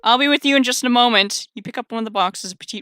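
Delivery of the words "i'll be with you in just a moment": raised, higher calling voice. Acted. Baker calling out to waiting customer, raised voice. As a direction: call it through to the next room. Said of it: "before you call out into the front".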